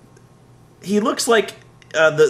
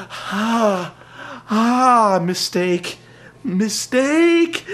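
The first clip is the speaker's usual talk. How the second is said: hurt wrestler voice; 6 semitones higher, much slower than usual. Retold or imitated. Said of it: "Wade comes back and he's like".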